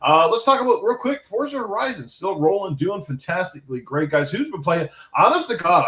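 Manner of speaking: wired host kicking off the topic; too much caffeine. Real, not acted. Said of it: "I'm a little bit fired up".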